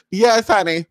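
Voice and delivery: ordinary talk, nothing marked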